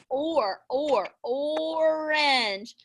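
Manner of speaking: "orange" said very slowly, drawing out "or"; stretched out. Shown as a demonstration. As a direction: draw the word out. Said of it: "drawn-out demo".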